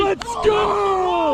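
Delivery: high thrilled shout; excited yell. Real, not acted. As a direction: yell out in triumph. Landing big fish.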